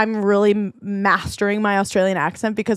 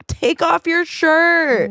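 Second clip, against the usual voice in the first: flirty joke; 7 semitones higher, much slower than usual. Retold or imitated.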